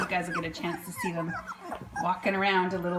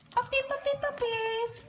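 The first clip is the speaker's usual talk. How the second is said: puppy talk. Real, not acted. Cooing at newborn puppies.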